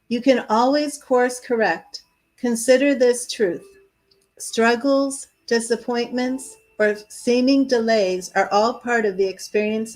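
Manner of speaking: measured, expressive oracle message reading; steady, projected inspirational reading; card reading aloud. Acted. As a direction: read it out with deliberate emphasis and pauses.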